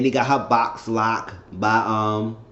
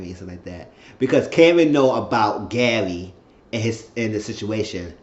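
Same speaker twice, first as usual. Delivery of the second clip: ordinary talk, nothing marked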